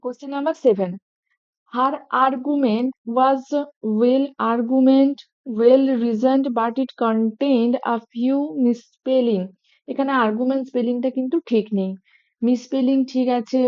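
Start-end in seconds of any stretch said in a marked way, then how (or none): 1.7-9.4 reading the sentence very slowly, word by word; slow dictation read. Shown as a demonstration. Teacher reads the test sentence slowly to the class. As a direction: dictate it slowly so students can follow. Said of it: "সেন্টেন্সটা একবার পড়ে".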